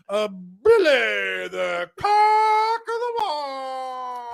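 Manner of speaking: slow, loud ring announcer; drawn-out big introduction. Acted. Announcing the cameraman like a host.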